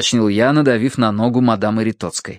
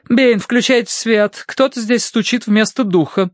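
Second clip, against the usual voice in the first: high, commanding order; imperious; 11 semitones higher than usual. A narrator's reading. Madame suspects a fake and orders lights, imperious. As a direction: order the butler sharply. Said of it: "повторила мадам Эритоцкая властно".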